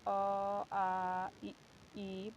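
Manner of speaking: अ, आ, इ, ई said one by one, slowly; reciting vowels. Shown as a demonstration. Teacher to students, slowly modelling the vowels. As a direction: model each vowel clearly. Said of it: "हम स्वर के उच्चारण करते समय".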